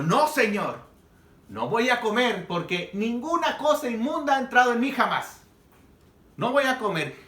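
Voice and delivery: a firm scandalized refusal; Peter's refusal. Acted. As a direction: insist you won't eat it, indignant. Peter refuses God's command.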